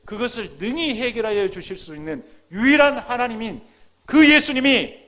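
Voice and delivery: loud, high climactic proclamation; impassioned preaching. Real, not acted. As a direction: build to a ringing shout. Sermon building to a climax.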